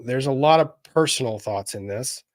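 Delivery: normal talking voice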